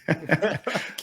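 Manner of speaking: high chuckle; amused laugh. Real, not acted. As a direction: laugh helplessly at a bad impression. Laughing at the accent impression.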